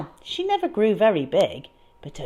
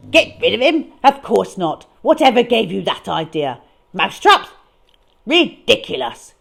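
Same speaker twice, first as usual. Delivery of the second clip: louder, pompous indignation; indignant rant; 8 dB louder than usual. Acted. Mister Fame ranting indignantly at the disposal man. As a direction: bluster at the man. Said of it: "getting more and more indignant".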